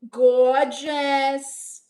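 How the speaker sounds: high, loud, drawn-out sing-song; NPC catchphrase. Shown as a demonstration. Streamer demonstrating her own NPC line, high sing-song greeting. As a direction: stretch each greeting out like a game character. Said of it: "mine would be like".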